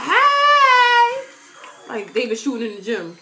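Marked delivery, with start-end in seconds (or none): 0.0-1.2 very high, loud yell; excited shriek. Retold or imitated. Friend shrieking when Diggy turns around, high and loud. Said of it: "she's like".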